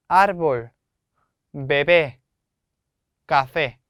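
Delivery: árbol loud on AR, bebé and café loud on the final syllable; syllable stress. Shown as a demonstration. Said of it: "힘을 줘서 발음을 해야". (árbol은 첫 음절, bebé와 café는 끝 음절을 세게)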